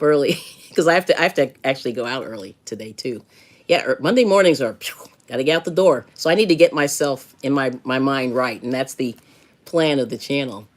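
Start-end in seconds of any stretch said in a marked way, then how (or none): none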